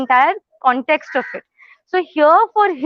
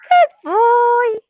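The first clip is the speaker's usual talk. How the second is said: excited praise; 8 semitones higher, 4 dB louder than usual. Real, not acted. Excited praise after recall.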